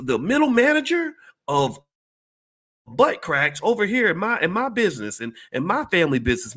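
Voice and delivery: mock a smug boss buttering up his worker. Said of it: "they said".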